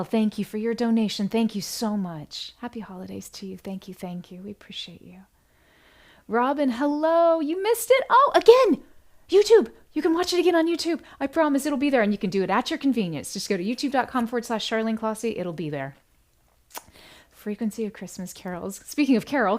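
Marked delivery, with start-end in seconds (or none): 4.4-5.2 whisper. Real, not acted. Host thanking donors on livestream. 6.3-15.4 excited. Real, not acted. Greeting viewer who missed the show.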